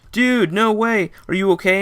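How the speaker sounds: stunned, slow disbelief, then worry; shocked concern. Retold or imitated. Roommate hears his friend was at the blast, stunned and loud.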